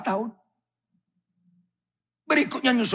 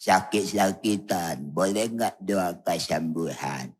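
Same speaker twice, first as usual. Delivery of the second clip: put-on lower, slow, frail old woman voice; old granny; 12 semitones lower, 3 dB quieter, slower than usual. Elderly woman asking the pastor for healing, frail. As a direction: plead like a creaky grandma.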